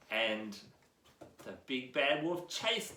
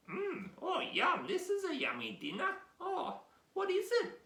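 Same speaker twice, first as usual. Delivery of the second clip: delighted pig at dinner; 12 semitones higher than usual. Acted. Pigs enjoying dinner.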